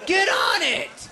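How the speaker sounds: bossy mock grandma, stretching the last words; impatient grandma. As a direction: holler orders as an impatient grandmother. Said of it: "Grandma, I go to that school".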